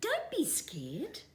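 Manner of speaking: calm the frightened chick gently; reassuring whisper. A narrator's reading. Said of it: "she said".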